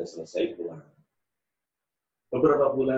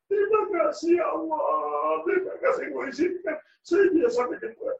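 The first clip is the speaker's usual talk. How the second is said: mother's tearful thanks; 13 semitones higher than usual. Acted. Mother's grateful prayer at Ka'bah.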